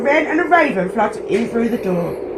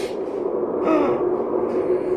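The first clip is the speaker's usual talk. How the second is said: soft breathy gasp before a sneeze; the gasping start of a sneeze; building sneeze intake; 2 semitones higher, 7 dB quieter than usual. Acted. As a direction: draw in air like a sneeze is coming. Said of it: "makes the bear sneeze".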